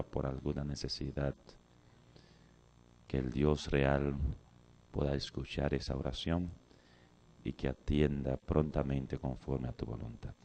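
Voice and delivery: low, soft, reverent prayer; opening prayer. Real, not acted. Preacher prays for the congregation before the lesson, soft prayer voice. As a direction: pray quietly and evenly, with pauses between phrases.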